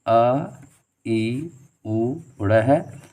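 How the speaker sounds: each letter said slowly and apart; slow spelling out. Shown as a demonstration. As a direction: spell out the sounds one by one, slowly. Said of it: "अण प्रत्याहार के अंतर्गत". (धीरे-धीरे एक-एक वर्ण बोलते हुए)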